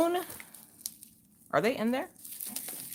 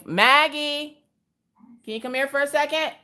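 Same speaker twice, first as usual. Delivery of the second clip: calling out; 11 semitones higher than usual. Real, not acted. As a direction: call her name to the next room. Calling the child from another room.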